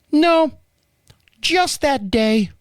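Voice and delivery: put-on high, slow girlish answer; little girl on phone. Bank rep correcting the caller, high little-girl voice. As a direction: explain it slowly in a little-girl voice. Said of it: "I get this little girl on the phone".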